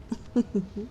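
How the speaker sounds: quiet, short predatory chuckle; menacing chuckle. Acted. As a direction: chuckle softly at your own threat. Predator amused by its own threat.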